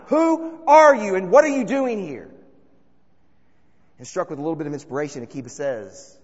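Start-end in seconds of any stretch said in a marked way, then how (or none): 0.1-2.3 sentry calls out. Acted. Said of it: "sentry calls out one more time".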